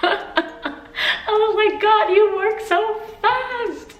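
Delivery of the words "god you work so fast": high, slow, laughing amazement; freaking out. Retold or imitated. Thanking God for the sudden celebration, laughing freak-out. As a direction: marvel through laughter, stretching the words. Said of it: "freaking out".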